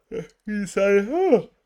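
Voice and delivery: words stretched by a big yawn. Real, not acted. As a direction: talk through a yawn, pitch jumping up. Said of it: "sorry, I'm yawning".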